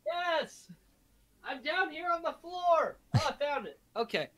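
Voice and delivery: calling up from the floor; distant voice from the floor; far away. Real, not acted. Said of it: "Is that why you sound so far away?".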